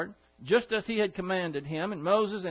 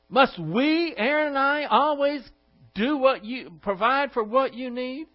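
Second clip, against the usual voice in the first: loud, higher, exasperated demand; livid Moses; 6 semitones higher, 4 dB louder than usual. Acted. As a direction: scold them as a fed-up leader. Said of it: "he is livid".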